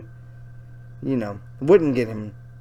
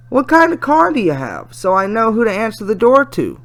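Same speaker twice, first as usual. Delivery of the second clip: lightly voiced girl, a bit higher; curious teen girl; 9 semitones higher, 8 dB louder than usual. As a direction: read her question in a light girlish voice. Reads chat as teenage girl.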